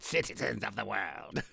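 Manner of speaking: drawn-out supervillain proclamation; Cobra Commander. Pretend. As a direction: address the world like a cartoon tyrant. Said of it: "from Cobra Commander".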